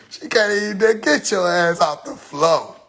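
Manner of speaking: usual speaking voice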